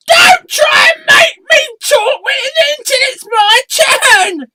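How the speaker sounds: squeaky, high puppet voice shouted; a shrill little puppet yelling to get his turn; high cartoon puppet shout; red sock puppet.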